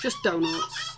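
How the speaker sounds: wailing Yorkshire whine; Yorkshire accent. Acted. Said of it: "I'll now go back to my Yorkshire accent".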